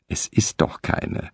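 whispered, without looking up; quiet dismissal. Acted. Wife knitting mutters to her husband, whispered. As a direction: brush him off under her breath. Wiederholte die Frau.

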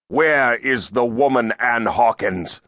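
demanding. A narrator's reading. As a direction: demand an answer from the accused. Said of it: "Lazarus demanded".

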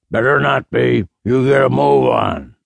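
gruff barked order, rising to a shout; father barking at son before hanging up; grunting father. A narrator's reading. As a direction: grunt it then bark the command. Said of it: "Louis grunted".

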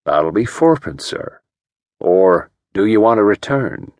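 mild West Country ferryman, easygoing; an old boatman's West Country lilt, lightly voiced; soft West Country voice. A narrator's reading. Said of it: "The ferryman's soft West Country voice said".

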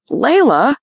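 high, loud call of her name; sudden worried yell. A narrator's reading. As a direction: shout her name in alarm. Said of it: "yelled Shane".

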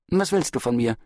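mildly irritated brush-off; dismissive. A narrator's reading. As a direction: brush the questioner off. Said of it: "erwiderte Farage abweisend".

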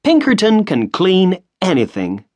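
high proud boast; smug boast. A narrator's reading. Washington bragging to the family, high and smug. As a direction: brag about the brand. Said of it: "smiling at the others".

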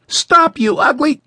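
high, loud cry cut off mid-word; boy's shout. A narrator's reading. Boy yelling at the giant until a hand covers his mouth. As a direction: yell at the giant before being silenced.

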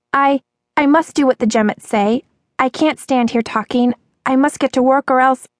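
put-on high, hesitant girl's voice; high-pitched worried waitress; anxious waitress. A narrator's reading. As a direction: fret in a high voice, stammering at first. Frightened waitress voiced in story.

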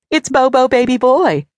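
high, loud, proud mother voice; mother raccoon. A narrator's reading. Mother raccoon proudly repeats child's name.

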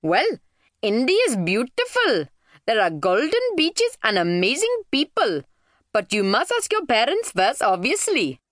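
high, lilting mum voice; friendly mother figure, enthusing. Acted.

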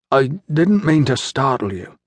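careful speech around a swollen jaw; slow through swollen jaw. A narrator's reading. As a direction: speak carefully, trying not to mangle the words. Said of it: "he spoke slowly, trying not to let his swollen jaw mangle his words".

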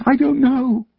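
put-on high small child's whimper; a frightened boy whimpering his answer. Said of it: "I whimpered".

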